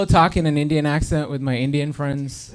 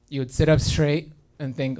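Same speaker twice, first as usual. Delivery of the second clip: ordinary talk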